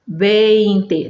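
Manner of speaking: "vinte" said as Spanish VEIN-te, E then I; drawn-out VEIN in "vinte", e gliding to i; correct Spanish pronunciation. Shown as a demonstration. Teacher showing learners the E-I sound, correct Spanish pronunciation. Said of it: "não é pra ditongar, não é iê, é E I".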